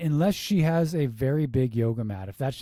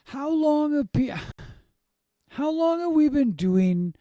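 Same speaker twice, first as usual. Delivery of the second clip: much higher, exasperated outburst; 12 semitones higher than usual. Real, not acted. Reviewer fed up with yoga teachers' habits, exasperated. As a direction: ask it high, in disbelief. Exasperated rant about common mispronunciation.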